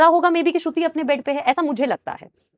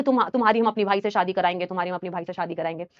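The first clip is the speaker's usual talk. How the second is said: neighbour didi; 8 semitones lower, 7 dB quieter, faster than usual. Retold or imitated. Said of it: "तो कहती थी".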